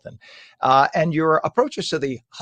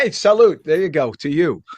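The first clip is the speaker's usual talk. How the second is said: cheerful toast; 4 semitones higher, 8 dB louder than usual. Real, not acted. Coffee cup toast on air.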